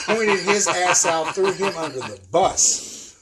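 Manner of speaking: laughing. Real, not acted. Storyteller amused at Steve giving Bill up, laughing loudly. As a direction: crack up while getting it out.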